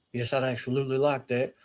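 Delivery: soft, low, smooth reply; persuasive put-on voice. Borrower accepts the cash, soft deep voice. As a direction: accept quietly in a deep fake voice. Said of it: "if I talk a certain way".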